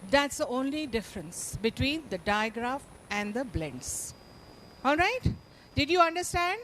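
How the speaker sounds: ordinary talk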